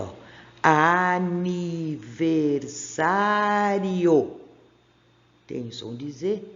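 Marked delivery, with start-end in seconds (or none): 0.6-4.4 stretched out, every syllable separate; very slow syllable by syllable. Shown as a demonstration. Teacher sounds out a long word for pupils, slowly. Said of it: "Olha essa aqui comprida".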